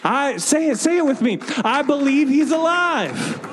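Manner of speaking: fiery preaching. Acted. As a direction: preach it out with fire. Preaching at full force.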